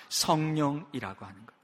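usual speaking voice

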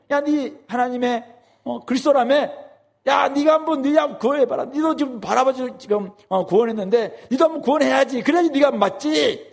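high taunting jeer at a condemned man; mocking sneer. Officials jeering at Jesus on the cross, mocking. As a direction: taunt him, high and scornful. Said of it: "지금 이렇게 비웃는 거예요".

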